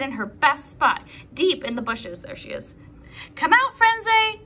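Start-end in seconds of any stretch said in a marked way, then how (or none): 2.2-2.7 soft unvoiced whisper. Real, not acted. Hushed aside spotting Frenzy. 3.3-4.4 louder, higher call to a hidden friend; shouted. Acted. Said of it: "shouted Legs".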